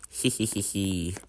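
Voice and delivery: low villain giggle in a strange voice; sneaky giggle. Acted. Banned player's tormentor giggling at them, sly giggle. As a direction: giggle like a gleeful troublemaker.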